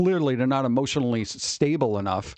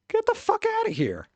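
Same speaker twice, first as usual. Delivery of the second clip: scoffing. Real, not acted. Streamer dismissing a crying coworker, scoffing high. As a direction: get the fuck outta here, pitched way up.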